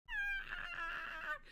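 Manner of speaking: very high, quiet squeal; held-back scream. Real, not acted. Streamer scared of the candy, small high squeal. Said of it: "I'm going to scream".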